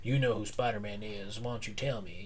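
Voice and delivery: low, quiet sinister threat; menacing villain. Retold or imitated. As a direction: lean in with a hushed threat. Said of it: "he's telling Doc Ock".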